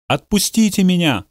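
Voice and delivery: high, desperate plea; desperate cry. Acted. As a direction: cry out to be let go. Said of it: "во весь голос взывала она".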